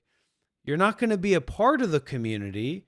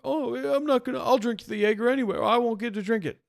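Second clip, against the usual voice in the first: much higher, fast mock whine; mocking whiny voice; 7 semitones higher, faster than usual. Mocking a donor over the Jaeger money, high whine.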